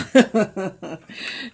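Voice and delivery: quick delighted laugh; bright laugh. Real, not acted. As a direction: laugh with joy at the chat. Laughing after greeting viewers.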